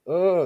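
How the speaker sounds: one high, loud mock yelp; startled yelp. Neighbor jumps up surprised, imitated startled yelp. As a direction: imitate someone jumping out of their skin. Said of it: "he, like, jumps up".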